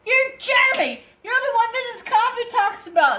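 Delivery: very high, excited mock squeal; astonished fans. Fans reading his name on the card, high astonished imitation. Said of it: "they're both like".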